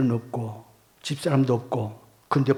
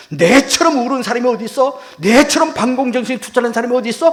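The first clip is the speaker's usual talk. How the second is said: high, loud, emphatic rhetorical questions; indignant protest; 8 semitones higher, 6 dB louder than usual. Retold or imitated. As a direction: protest innocence with force. Protesting the accusation to himself. (억울한 듯 높고 크게 항변)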